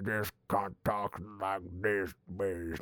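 garbled drawl out the side of the mouth; Jeff Bridges. Retold or imitated. Reviewer imitating Rooster Cogburn for listeners, mumbled through a mouthful of chew. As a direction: talk round a wad of chewing tobacco. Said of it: "talking out the side of his mouth".

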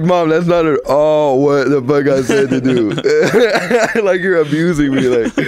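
laughing through the complaint; laughing protest. Retold or imitated. Son laughing, protesting to mom about the beating, laughing. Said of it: "I'm like".

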